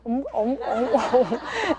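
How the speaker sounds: little sucking "mm" noises; soft, repeated mock sucking hums; imitated thumb-sucking. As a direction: mimic a baby working its jaw on a thumb. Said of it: "see her little jawbones going".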